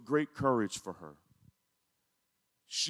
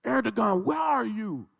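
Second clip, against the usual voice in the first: pleading call; 6 semitones higher than usual. Retold or imitated. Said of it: "She said".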